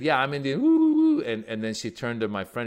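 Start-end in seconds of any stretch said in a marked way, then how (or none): 0.0-1.2 high loud whooping woo back; mock war whoop. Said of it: "she said".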